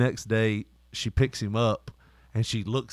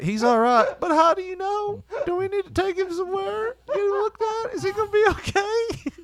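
much higher, hammed-up worried squeak; mock panicked fussing. Dad to daughter, mock worried in a silly high voice. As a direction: play at fretting in a silly high voice.

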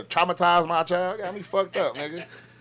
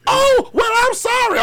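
much higher, squeaky apology; mock timid teacher; 4 dB louder, slower than usual. Mocking the teacher apologizing to the angry father, high squeaky voice. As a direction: play the scared teacher backing down.

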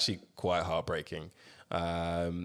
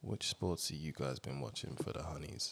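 hushed cheeky; 7 semitones lower, 14 dB quieter than usual. Acted.

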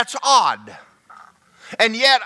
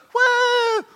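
put-on kid's whoop. Kid runs through sprinkler.

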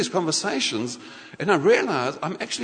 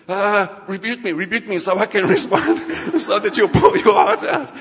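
loud, laughing mock-eager plea; eager begging; 4 semitones higher, 8 dB louder than usual. Volunteer begging God for a rebuke, laughing eagerness. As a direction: beg loudly through laughter, rising at the end.

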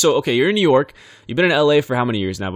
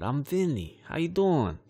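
softer, slowed-down New York intro; slower New York accent; 3 semitones higher, 8 dB quieter, slower than usual. Shown as a demonstration. Host retrying the intro slower, as coached, in a New York accent. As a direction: ease it out slowly, like a wiseguy. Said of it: "Kinda a little slower".

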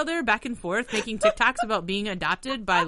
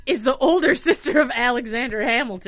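reading through laughter; laughing while reading; 5 semitones higher, 9 dB louder than usual. Real, not acted. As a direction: try to read it but keep cracking up. Reads synopsis through laughter.

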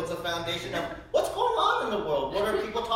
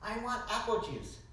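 a hint of a whiny three-year-old, higher; light childish ask for "apple juice"; lightly voiced toddler asking; 6 semitones higher than usual. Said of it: "he's like".

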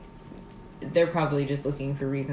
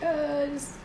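mock tearful; 10 semitones higher, 5 dB quieter than usual. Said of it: "she was like".

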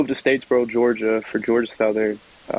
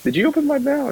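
much higher, mock-indignant question; retold disbelief; faster than usual. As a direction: act out catching grandpa opening your mail. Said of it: "I was like".

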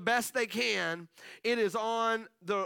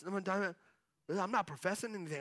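usual speaking voice

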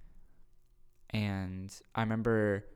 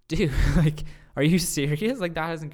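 loud, high, incredulous reaction; can't-believe-it outburst; amazed disbelief; 6 semitones higher, 9 dB louder than usual. Retold or imitated. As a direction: act out a friend's stunned disbelief at full volume. Retold pastor's amazed reaction.

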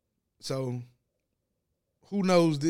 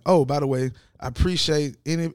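ordinary delivery

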